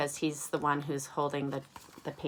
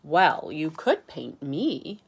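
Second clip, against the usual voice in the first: Arthur pondering; slower than usual. Acted. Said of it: "takes this seriously".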